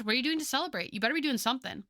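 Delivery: ordinary talk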